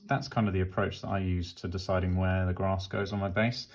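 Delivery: normal talking voice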